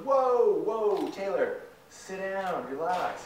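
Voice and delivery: high, loud "whoa" easing off; mock alarmed teacher. Teacher reining in excited speaker.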